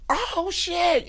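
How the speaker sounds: very high, stretched cry of amazement; drawn-out, high-pitched hype reaction; amazed exclamation. Retold or imitated. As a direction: react like somebody just dunked. Retold amazed reaction to freestyle.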